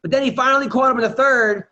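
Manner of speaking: ordinary talk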